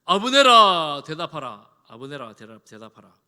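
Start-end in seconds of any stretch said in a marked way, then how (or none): 0.0-1.7 long, loud call across a distance. Acted. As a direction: project like calling to a far hillside. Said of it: "다윗이 소리칩니다".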